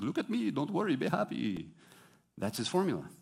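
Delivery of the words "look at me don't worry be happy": high, singsong mock quote. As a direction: imitate the carefree singer, starting high and falling. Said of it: "at one point in the video, he goes".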